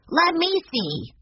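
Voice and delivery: put-on high, slow, eager boy's cry; Benny's shout. A narrator's reading. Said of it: "shouted Benny".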